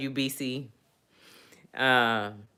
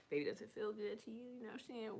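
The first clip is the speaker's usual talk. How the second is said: seductive murmur; 6 semitones higher, 25 dB quieter, much faster than usual. Pretend. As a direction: murmur it like pillow talk. Acting out bedroom talk.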